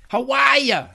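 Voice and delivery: put-on high, loud Boston-accented yell. A narrator's reading. Novelist reads his townspeople calling out, yelling. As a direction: holler it out as a greeting. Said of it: "people yelled".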